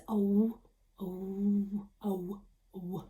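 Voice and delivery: "Oh, oh" said softly and rounded, fading away; soft rounded "oh" as the letter's sound. Shown as a demonstration. Teacher voicing what letter W says, softly. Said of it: "but he says".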